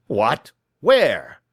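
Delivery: sharp, loud alarmed shout; startled cry of a cornered man. Acted. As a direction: blurt it out in panic, loud. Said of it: "shouted Mister Windibank".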